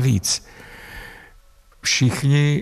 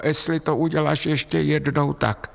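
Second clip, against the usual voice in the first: lightly voiced, higher-pitched threat; imitated parental warning; 6 semitones higher than usual. Acts out a typical parental warning. (naznačená rodičovská výhrůžka)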